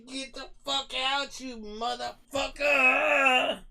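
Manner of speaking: high, slow shouted order; mock cop yell. Mimicking a cop at a car window, shouting. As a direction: bark it like a cop dragging someone out. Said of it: "and say".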